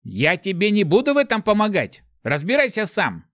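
high, loud, unfriendly refusal; ill-willed, heated retort; hostile. A narrator's reading. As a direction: refuse angrily, pitched right up. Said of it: "Как-то недобро прозвучал ответ".

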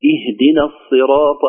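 slow اهدنا الصراط opening on a clear i; measured recitation. Shown as a demonstration. Teacher reciting the verse, measured, اهدنا starting with i. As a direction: recite اهدنا as ihdina, unhurried.

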